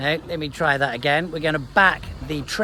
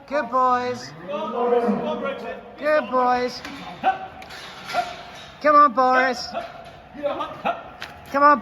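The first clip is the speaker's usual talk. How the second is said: driving call; 10 semitones higher than usual. Real, not acted. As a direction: push the bulls on into the pen. Driving bulls into market pen.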